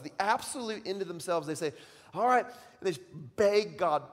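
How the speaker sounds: loud, high, driving sermon storytelling; preaching at full force. Acted. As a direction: preach it big, building the story. Retells Jonah at full force.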